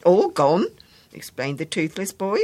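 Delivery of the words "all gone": "All gone?" louder, rising in disbelief; the boy can't believe "All gone"; outraged question. Acted.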